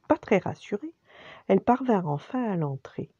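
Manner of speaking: ordinary delivery, nothing marked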